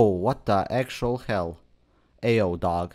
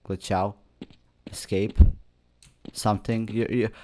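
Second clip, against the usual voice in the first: usual speaking voice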